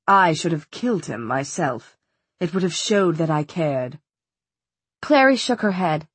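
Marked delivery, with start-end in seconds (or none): 0.1-4.0 low, controlled villain voice; smooth regret, pitched down; cold villain father. A narrator's reading. Father tells daughter he should have killed, cold silky voice.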